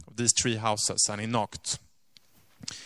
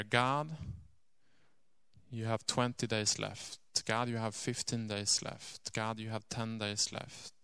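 softer, grave whispered-down prayer; hushed countdown prayer; 2 semitones lower, 9 dB quieter than usual. Retold or imitated. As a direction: count the days down to God quietly. Lady's daily countdown prayer.

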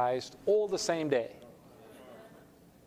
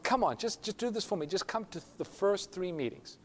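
higher-pitched pleading; acted coaxing; 6 semitones higher than usual. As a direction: plead with a reluctant brother, in retelling. Brother coaxes reluctant brother.

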